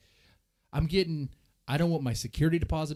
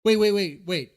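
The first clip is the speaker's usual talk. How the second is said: urgent interruption; 3 semitones higher, 7 dB louder than usual. Real, not acted. Host interrupts clip excitedly.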